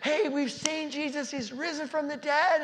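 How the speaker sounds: high, loud, thrilled news; excited announcement. Acted. Disciple shouting to the others that Jesus is risen, excited. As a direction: burst out with the good news.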